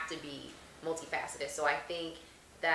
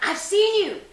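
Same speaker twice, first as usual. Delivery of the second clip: higher, louder insistence; insistent fan; 11 dB louder, slower than usual. Retold or imitated. Fan insisting to the actress, high loud insistence. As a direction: press the point like a sure fan. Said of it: "They're like".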